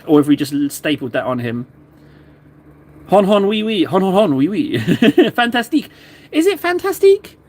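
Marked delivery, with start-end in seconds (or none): 3.1-5.9 hammed-up "hon hon oui oui" French caricature ending in a laugh. Teasing viewer with a mock French laugh and accent. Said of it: "decided that he's French". 6.3-7.4 much higher, sing-song mock French question. Streamer guessing the French word for viewer in mock accent. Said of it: "decided that he's French".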